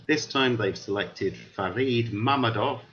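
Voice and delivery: normal talking voice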